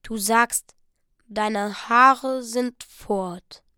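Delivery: very slow, stunned words; dazed. Acted. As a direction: drag it out, uncomprehending. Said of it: "mit fast idiotischem Ausdruck". (sehr langsam, wie betäubt)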